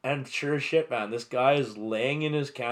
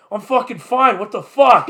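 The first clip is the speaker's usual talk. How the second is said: put-on higher and louder, an offended drunk; a drunk guy insisting he's fine, higher and louder; indignant snap; 10 semitones higher, 11 dB louder than usual. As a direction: bristle back at them like you're insulted. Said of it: "And he's like".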